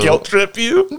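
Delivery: high, slow mocking question; high sing-song tease. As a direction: drag it out teasingly. Friend chiming in mockingly.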